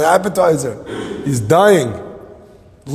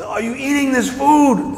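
slow, high-pitched mock shout; acted yell to someone who didn't hear; shouted repeat; 11 semitones higher than usual. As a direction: holler it across a distance, drawing out each word. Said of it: "I can't hear you, what?".

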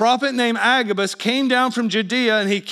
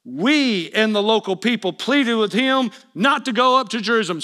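usual speaking voice

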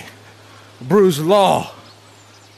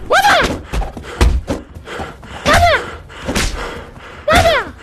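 high taunting yell. Pretend. Taunting opponent in boxing game.

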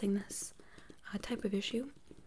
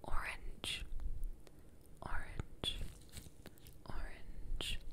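spaced-out whispers, close to the ear; whispered test word; much slower than usual. Pretend. Doctor testing which ear hears the word, whispering. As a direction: whisper the word, leaving long gaps. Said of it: "I'm gonna whisper something to you".

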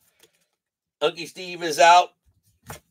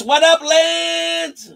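drawn-out greeting; 10 semitones higher, much slower than usual. Real, not acted. Greeting a viewer.

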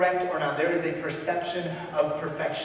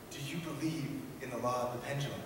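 much softer, hushed and menacing question; leaning in, hushed; 3 semitones lower, 11 dB quieter than usual. Retold or imitated. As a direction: lean in close and press him quietly. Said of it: "he leans in, he says".

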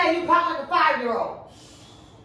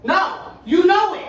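loud, slow, higher-pitched correction; emphatic rebuke; 4 semitones higher, 5 dB louder, slower than usual. Real, not acted. Partner rejects his half-hearted answer, emphatic and cross. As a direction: hit each word hard and drag it.

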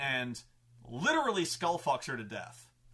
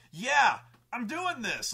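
loud, raised, drawn-out defiance; Tempest; 10 semitones higher, 7 dB louder, slower than usual. Retold or imitated. Villain to horrified crowd, emphatic defiance. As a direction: imitate a defiant villain, slow and higher. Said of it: "so Tempest is like".

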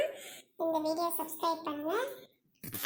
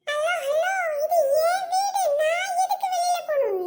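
much higher and louder, with a taunting laugh; sing-song jeering laugh; mocking defiance; 11 semitones higher, 5 dB louder than usual. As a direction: laugh in his face while you refuse. Wife mocks husband's order.

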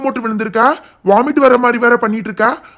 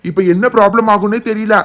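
ordinary delivery

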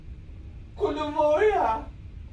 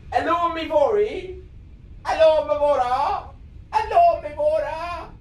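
hammed-up British accent, casting a spell; exaggerated British accent; 2 semitones higher than usual. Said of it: "Let's put more of a British accent on".

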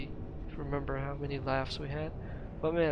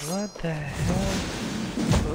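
slow, stretched, louder disbelief; shocked; 6 dB louder, much slower than usual. Real, not acted. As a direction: drag out the disbelief. Shocked at the game.

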